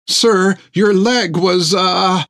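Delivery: timid doctor Thiggum explains to the raging giant, high and halting; nervous little doctor. Acted.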